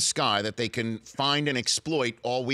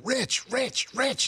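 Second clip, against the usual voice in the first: high-pitched mock yell, name repeated; imitated fan screaming; much slower than usual. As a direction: holler like fans from the stands, pitched way up. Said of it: "people are screaming".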